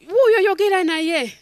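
loud, high exasperated urging; loud parent urging. Retold or imitated. Parent loudly urging the friend to talk to the child. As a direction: push the friend to talk to him.

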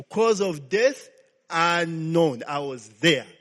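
loud, slow, emphatic preaching; preaching at full force. Acted. Preacher telling congregation the autopsy finding, loud emphatic preaching. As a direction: hammer "unknown" home to the church.

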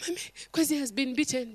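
quick, hushed telling on him; hurried report. Retold or imitated. Little brother hurriedly telling mommy about the beating. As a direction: rush it out to mommy. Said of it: "the little brother was there".

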